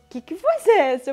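sudden alarmed question; startled outburst. Retold or imitated. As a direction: blurt it out, jolted by the voice. Startled by a voice in her head.

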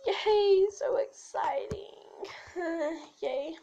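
slow, whispery cheer trailing down; unintentionally sarcastic "yay"; sarcastic-sounding. Real, not acted. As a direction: cheer half-heartedly, dropping to a whisper. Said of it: "I probably sound really sarcastic".

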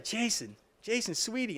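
put-on high, soft little old lady cooing; Polly's sweet coo. Old lady Polly sweetly calls Jason, high soft coo. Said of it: "and Polly's like".

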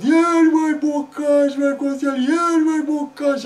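hammed-up child's bawling plea; tearful begging. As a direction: wail loudly for what you want. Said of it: "plângând să ne iei niște bocanși".

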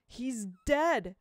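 loud desperate shout; yelled over the roar. Acted. Will shouts over the noise.

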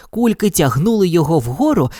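ordinary delivery, nothing marked

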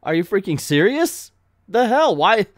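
mock exasperated disbelief; incredulous outburst. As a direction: react as if utterly fed up. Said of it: "I'm adding that "Are you serious?" bit".

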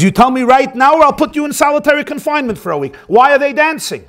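high, rapid-fire angry demand; furious. Acted. Prison warden threatens a Gentile inmate with solitary, furious. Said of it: "he's astounded and he's furious".

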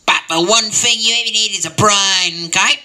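put-on high, loud old grandpa voice; mock grandfatherly lecture; grandpa's advice. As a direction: preach it like a wise old grandpa. Grandpa giving advice in story.

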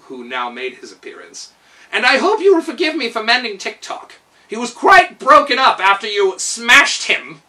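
high, loud proud steward; pleased and eager to please; Kaliko. A narrator's reading. Steward boasting to the king about mending the robot.

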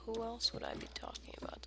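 fast hushed comeback; whispered retort. Pretend. Gamer arguing with himself, shooting back in a whisper.

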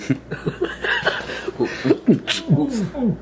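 soft high giggling; high giggle. Real, not acted. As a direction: giggle at his gagging. Friend gagging.

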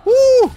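high, loud "woo"; excited whoop. Real, not acted. Streamer celebrates a good moment in the game, high whoop. Cheer during gameplay.

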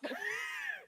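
high shrieking burst of laughter. Real, not acted. Laughing after bumping into friend.

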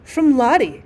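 ordinary delivery

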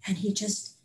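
ordinary talk, nothing marked

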